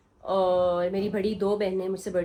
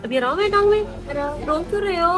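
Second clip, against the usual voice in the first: coaxing concern; 6 semitones higher, 3 dB louder, faster than usual. Real, not acted. Aunt to tearful child with hurt leg, coaxing concern. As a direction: comfort a crying little one.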